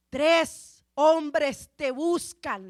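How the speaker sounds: slow, spaced-out words; solemn pronouncement. Retold or imitated. Preacher quotes the Holy Spirit to Peter, slow pronouncement. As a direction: deliver it as a weighty message, word by word.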